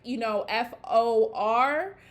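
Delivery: F O R said as separate letters, eff oh are; spelled out letter by letter. Shown as a demonstration. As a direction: spell out F O R, one letter at a time. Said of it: "Maybe it's for someone too".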